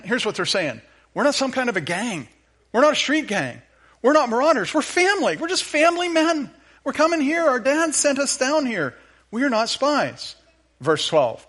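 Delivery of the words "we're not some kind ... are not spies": higher, rising plea of frightened brothers; pleading brothers. Retold or imitated. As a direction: mock-desperate brothers insisting they are family. Brothers pleading voiced in retelling.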